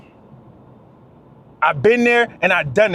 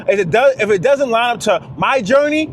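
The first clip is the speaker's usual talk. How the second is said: ordinary talk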